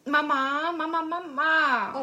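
high, loud, slow repeated Mama; mechanical crying doll. Acted. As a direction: whine it over and over like a doll. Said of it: "das Kind, was [name] jetzt spielt".

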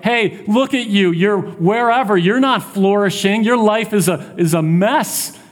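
high-pitched, needling taunt; mocking enemy, pitched well up. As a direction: jeer at a struggling believer. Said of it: "The enemy is taunting him".

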